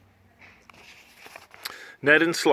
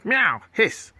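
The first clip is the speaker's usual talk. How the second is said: higher meow and hiss; cat hiss; 10 semitones higher than usual. Acted. Cats swiping again.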